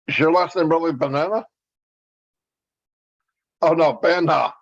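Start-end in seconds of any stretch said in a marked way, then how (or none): none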